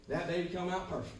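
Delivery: ordinary talk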